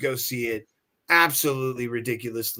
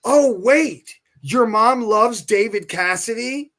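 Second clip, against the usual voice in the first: much higher, louder, drawn-out surprise; mock excited; 8 semitones higher, 5 dB louder, slower than usual. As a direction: hype it up as a pretend realization. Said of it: "I don't go".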